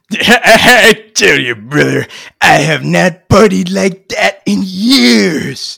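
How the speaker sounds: put-on slow, slurred, laughing drunk. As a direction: giggle through it, tipsy. Drunk and giggling after party.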